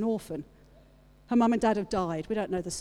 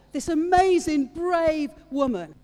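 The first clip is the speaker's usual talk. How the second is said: higher and louder burst of admiration; excited praise; 8 semitones higher, 8 dB louder, slower than usual. Real, not acted. Preacher praising Esther to the church, excited and loud. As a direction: lift it up as a cry of praise.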